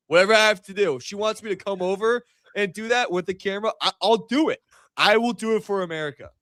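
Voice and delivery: volunteer like a joking patriot; mock heroic eagerness.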